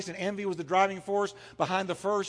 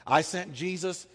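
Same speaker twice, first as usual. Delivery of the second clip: usual speaking voice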